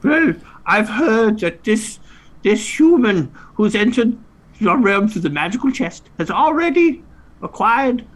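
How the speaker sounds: very high, squeaky fairy creature; high fantasy insect voice. Acted. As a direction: squeak like a tiny winged creature. Eighties fantasy scene.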